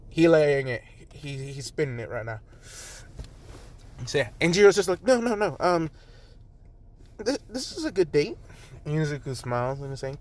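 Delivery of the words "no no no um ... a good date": high-pitched, hurried backpedal; flustered and eager to correct; flustered reassurance. Acted. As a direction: stammer a nervous reassurance, pitched up. Flustered hasty reassurance in story.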